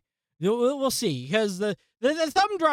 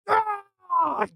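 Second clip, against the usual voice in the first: high frustrated yelp; 13 semitones higher than usual. Real, not acted. Computer crashed again.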